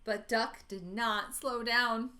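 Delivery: ordinary delivery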